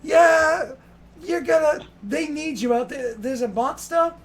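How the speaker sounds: high, loud urging; exasperated call. Acted. Woman shouts that a monster needs fighting. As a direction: shout him out the door. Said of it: "and she's like".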